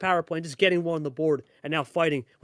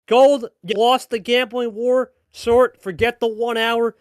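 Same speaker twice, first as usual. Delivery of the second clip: hyped slow call; 6 semitones higher, 7 dB louder, slower than usual. Real, not acted. Caster hypes the win.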